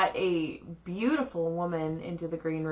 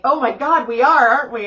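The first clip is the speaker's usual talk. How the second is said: loud, higher exclamation; retold gushing agreement; excited realization; 4 semitones higher, 10 dB louder than usual. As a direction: exclaim it as it suddenly dawns on you. Friend's sudden realization, retold.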